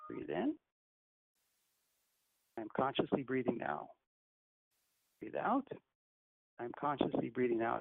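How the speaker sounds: soft, hushed meditation guidance; guided breathing. Acted. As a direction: guide the breaths gently and calmly.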